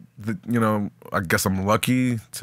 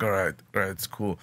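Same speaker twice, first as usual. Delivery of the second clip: quiet self-calming; 2 semitones lower, 8 dB quieter than usual. Retold or imitated. Calming himself in the story.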